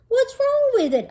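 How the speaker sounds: defensive squeak. Acted. Boy defensive about lunchbox.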